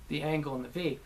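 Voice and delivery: ordinary delivery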